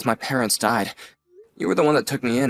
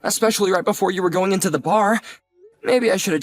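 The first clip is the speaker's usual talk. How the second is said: flustered apology; 6 semitones higher than usual. Acted. Nephew who made his aunt cry, flustered and sorry. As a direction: hurry to apologize.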